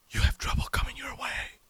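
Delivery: high, hushed mystic warning; lightly voiced psychic, spooky and breathy; imitating the psychic. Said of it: "he would".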